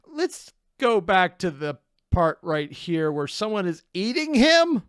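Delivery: excited. Real, not acted. Startled by a detail.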